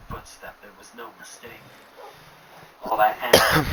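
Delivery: usual speaking voice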